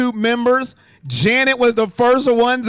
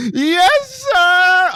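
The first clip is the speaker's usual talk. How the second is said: much higher and louder "Yes, sir"; loud catchphrase shout; 8 semitones higher, 3 dB louder than usual. Acted. Streamer shouting his catchphrase for new members, loud and high. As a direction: belt the catchphrase out at full volume. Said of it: "That's probably loud".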